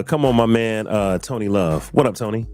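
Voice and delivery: usual speaking voice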